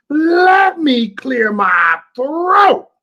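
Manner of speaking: high, drawn-out rap shout. Acted. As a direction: holler it loud, stretching every word out long. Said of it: "In my Beastie, uh, Boys voice".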